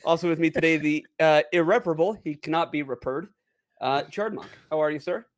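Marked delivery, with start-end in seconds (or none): none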